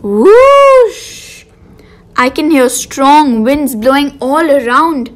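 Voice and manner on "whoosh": high loud whooshing wind sound. Pretend. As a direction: blow like a howling gale. Imitating strong winds.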